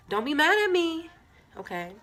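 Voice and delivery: high, loud mock pleading; playful plea. Real, not acted. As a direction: beg them not to be mad. Playful plea before secret.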